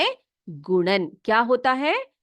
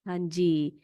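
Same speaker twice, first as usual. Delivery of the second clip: ordinary talk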